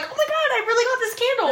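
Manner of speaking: giggly mock excitement; mock amazement. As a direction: play a winner thrilled by a candle. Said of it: "you'd be like".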